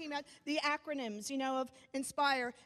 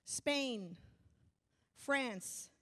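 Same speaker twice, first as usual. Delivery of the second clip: ordinary talk, nothing marked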